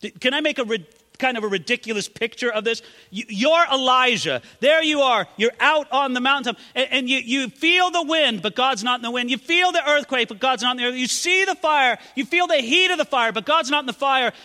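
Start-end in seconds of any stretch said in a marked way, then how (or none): 3.1-14.3 higher, louder rising build; building sermon. Acted. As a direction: paint the scene with mounting force. Building mountaintop picture.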